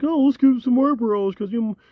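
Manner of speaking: high, mock-cheerful voice; mocking the toy makers.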